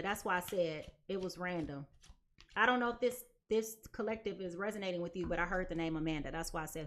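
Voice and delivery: ordinary delivery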